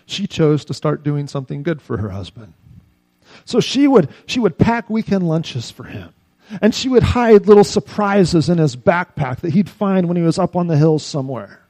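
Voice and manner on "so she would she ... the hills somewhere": preaching. Acted. Preaching illustration.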